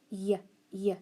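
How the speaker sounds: "Y" as a short "yuh"; isolated letter sound. Shown as a demonstration. Teacher quizzing pupils on spelling, "Y" said as "yuh". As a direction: say "Y" as its consonant sound, not the letter name. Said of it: "Okay, what about".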